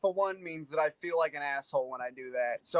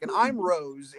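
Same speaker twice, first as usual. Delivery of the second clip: ordinary talk, nothing marked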